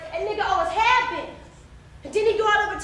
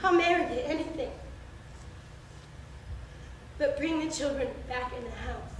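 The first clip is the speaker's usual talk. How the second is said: soft, lowered, careful pleading; subdued surrender; 7 semitones lower, 10 dB quieter than usual. Acted. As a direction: give in quietly so he won't react. Mother gives in to save children.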